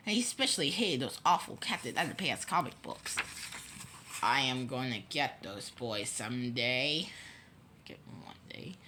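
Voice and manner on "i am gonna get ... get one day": very low, quiet villain voice; low grumble of a mean principal; menacing vow. A narrator's reading. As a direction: vow revenge under your breath. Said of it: "Mister Krupp vowed".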